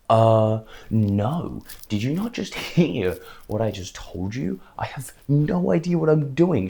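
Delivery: loud disbelief rising high at the end; incredulous protest. Retold or imitated. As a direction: push back, amazed she asks. Retelling his protest to her.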